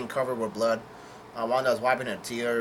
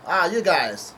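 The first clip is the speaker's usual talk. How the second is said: higher and louder, happy mock call; retold yell; 5 semitones higher, 5 dB louder, slower than usual. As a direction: shout it to the crew, half-acted. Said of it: "Luffy yells out".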